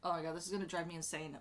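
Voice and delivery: ordinary delivery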